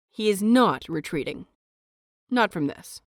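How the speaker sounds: ordinary delivery, nothing marked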